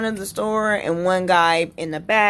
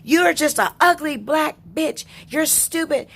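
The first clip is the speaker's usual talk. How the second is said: higher mock outburst of an insulting customer; retold insults; 7 semitones higher than usual. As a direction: act out the customer hurling insults, pitched up. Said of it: "And I'm going".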